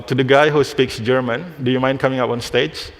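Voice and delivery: normal talking voice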